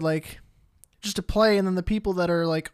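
ordinary talk

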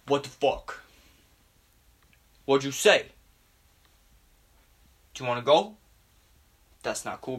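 lightly put-on douchebag tough talk; mock-threaten like a puffed-up bro; a hint of American douchebag bravado. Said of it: "slowly going from American douchebag".

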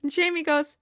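ordinary talk, nothing marked

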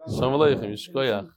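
normal talking voice

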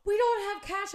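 high, very loud re-enacted protest; frantic mock cry. Partner objects during the ice cream rush, frantic mock cry. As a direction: exclaim it in comic panic. Acted quote of partner's alarm.

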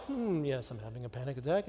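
low, soft, composed deadpan; serene exterior. Acted. Son calmly informing his mom of panic, deadpan. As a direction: understate it as if nothing is wrong. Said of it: "beneath a serene exterior".

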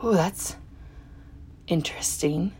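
quiet, halting, noncommittal; husband seeing the jumper, quiet and hesitant. Retold or imitated. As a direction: stall before a polite dodge. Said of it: "And he's like".

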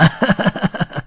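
high, breathy tramp's cackle; short gloating laugh in character; villainous chuckle. A narrator's reading. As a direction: laugh over a fresh catch. Tramp laughs over captured piglet.

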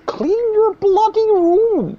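very high, slow, drawn-out squeak; mock him in a strained high voice; hammed-up high-pitched lecturer; Jordan Peterson impression. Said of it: "high-pitched voice".

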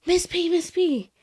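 put-on high, slow kid voice; kid calling. Fifth grader calling to his teacher, high and drawn out. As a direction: call out like a little boy wanting attention. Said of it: "He goes".

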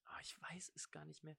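unvoiced, breathy whisper to himself. Real, not acted. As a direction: mutter it under your breath. Forgets where he met him.

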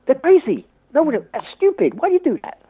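high-pitched mock disbelief; mock incredulity. Outsiders judging the crazy English, incredulous and high-pitched. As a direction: play at being baffled by such stupidity.

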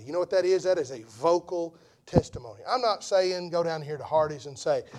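ordinary talk, nothing marked

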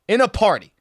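normal talking voice

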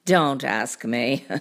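low cartoon cat voice; deep, unbothered storybook cat; dismissive cat. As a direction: brush it off in a deep voice. Cat shrugs it off.

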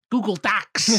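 high, loud catchphrase of a bad impression; terrible Christopher Walken. Shown as a demonstration. Warming up an impression for friends, terrible Walken. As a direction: hit it high and hard, hammed-up impression. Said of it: "my terrible Christopher Walken impression".

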